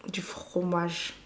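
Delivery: slow, drawn-out whisper; savouring whisper. Real, not acted. Listing French food she missed, savouring whisper. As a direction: whisper it lingeringly, like a delicacy.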